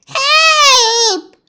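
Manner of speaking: high, loud desperate cry; cry for help. Acted. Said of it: "cried the witch".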